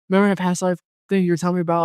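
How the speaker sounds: high, fast rush of excitement; excited retelling. Retold or imitated. Retelling a call to a friend about the vision, excited. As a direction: blurt it out high and quick. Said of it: "I was excited".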